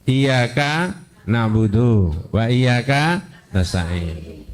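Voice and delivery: slow, measured recitation of the verse; slow Quranic reading. Shown as a demonstration. Teacher slowly reciting the correct Quran order to the student. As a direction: recite it slowly and carefully, as taught. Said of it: "membaca menurut yang diajarkan di Quran".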